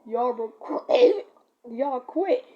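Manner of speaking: drawn-out cracking plea; slow voice crack. Shown as a demonstration. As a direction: stretch it slow and let it crack. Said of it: "No, but, like, it was like".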